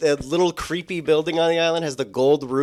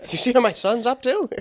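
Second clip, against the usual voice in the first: half-hearted, higher-pitched actor impression; Tom Hanks; 6 semitones higher than usual. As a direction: attempt the actor's voice and fail. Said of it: "I can't even do a Tom Hanks voice".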